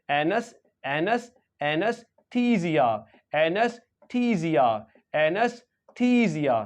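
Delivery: "एनस" first, then "एनेस्थीसिया" slowly with long "थी" and Z for S; pronunciation drill. Shown as a demonstration. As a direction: drill "एनेस्थीसिया" slowly in parts, falling each time. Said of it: "तो इस तरह से पढ़ रहे हैं".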